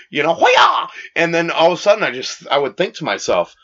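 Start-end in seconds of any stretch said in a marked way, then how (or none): 0.3-0.9 high, sharp battle cry; karate yell. Retold or imitated. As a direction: shout it like a kung fu strike. Acting out a kung fu move.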